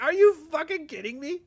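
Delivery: incredulous outburst. Retold or imitated. Disbelief at rapper's lyrics.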